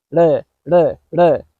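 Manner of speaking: le as an isolated quick tongue tap; fast D sound. Shown as a demonstration. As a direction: flick out le like a very fast D. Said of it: "a fast D sound".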